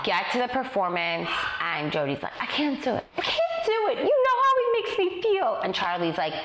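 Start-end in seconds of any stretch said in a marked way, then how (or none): 2.4-5.6 a hammed-up dancer panicking before the show, tearful and high; mock crying dancer. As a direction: play the dancer breaking down, half hammed-up.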